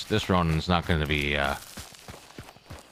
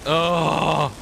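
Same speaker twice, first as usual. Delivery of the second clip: panicked yelling; 10 semitones higher, much slower than usual. Real, not acted. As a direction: yell in fear while laughing. Fleeing monster, laughing at self.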